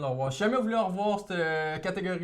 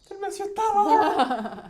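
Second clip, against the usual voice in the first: neighbor voice; 14 semitones higher, 6 dB louder than usual. Pretend. Voicing the neighbor man.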